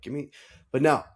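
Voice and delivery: ordinary talk, nothing marked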